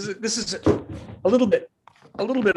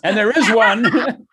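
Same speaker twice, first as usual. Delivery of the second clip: louder, slow, laughing through the words; real giggles mid-sentence; 4 semitones higher, 7 dB louder than usual. Real, not acted. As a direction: laugh while forcing the sentence out. Laughing through a failing call.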